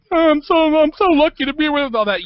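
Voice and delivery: hammed-up starstruck self-parody; mock gushing. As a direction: parody someone giddy with gratitude. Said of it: "silly and, and stupid and just like".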